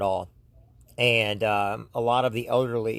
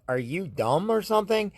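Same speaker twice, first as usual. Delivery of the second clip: retold scoff; 8 semitones higher than usual. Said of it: "I'm like".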